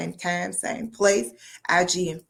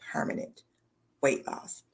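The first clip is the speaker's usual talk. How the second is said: whispered aside, no voice; 4 semitones lower, 7 dB quieter than usual. Real, not acted. Host to viewers, whispering the catch about cleanses. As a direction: let the last words drop to a whisper.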